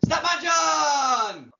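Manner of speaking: loud, high, very drawn-out name call; announcer's shout. Acted. Host summoning the star guest, long roaring shout. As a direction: bellow the name, stretching it right out.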